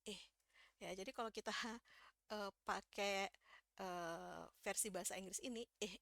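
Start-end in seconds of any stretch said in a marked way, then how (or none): none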